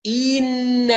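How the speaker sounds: إن with the kasra drawn out slowly into a ya; wrong stretched kasra. Shown as a demonstration. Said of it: "من التمطيط".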